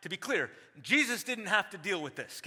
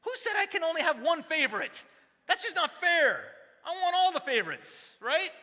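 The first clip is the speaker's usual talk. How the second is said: playful protest; 10 semitones higher, 5 dB louder than usual. Real, not acted. Playful mock protest about favorites.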